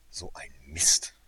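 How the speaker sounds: frustrated brother swearing; cursing. A narrator's reading. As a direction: spit it out in frustration. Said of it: "fluchte er".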